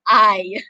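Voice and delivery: I said as a drawn-out long "eye" diphthong; long I sound. Shown as a demonstration. Teacher models the long I vowel, clearly drawn out. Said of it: "sounds like I".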